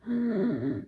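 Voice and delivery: sharp retold gasp for breath; a sudden panicked gasp, acted out. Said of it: "Eu comecei literalmente a ficar".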